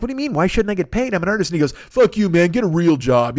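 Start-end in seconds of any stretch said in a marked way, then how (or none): 0.0-1.5 high-pitched incredulous artist imitation; acted-out indignant squeak of a question; mock disbelief. Acted artist protests not being paid. 1.7-3.4 loud, dismissive client imitation; heated brush-off, opening high and loud; mock anger. Acted client lashes out at artist.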